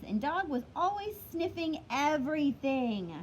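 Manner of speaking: usual speaking voice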